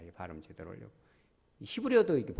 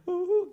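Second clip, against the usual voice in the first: short high wail; a quick mournful howl; jackal howl; 5 dB louder than usual. Shown as a demonstration. Preacher imitating the jackal cry, high wail. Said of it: "그걸 하는 거지".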